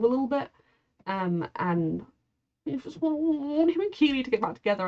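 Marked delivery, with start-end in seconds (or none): 2.7-4.9 slightly whiny, higher-pitched pleading. Real, not acted. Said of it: "I feel like a petulant child".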